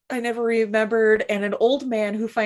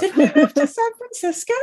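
mock character voice; 7 semitones higher, 5 dB louder than usual. Joke voicing the character.

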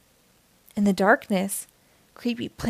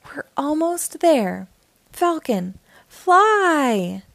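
excited cry; 6 semitones higher, much slower than usual. A narrator's reading. Blaze urges himself up the mountain, building to a cry. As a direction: launch himself with a rising cry. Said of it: "cried Blaze".

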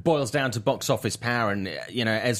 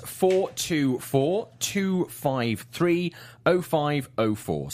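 slow and deliberate; 6 semitones higher, much slower than usual. Real, not acted. Giving out the call-in number.

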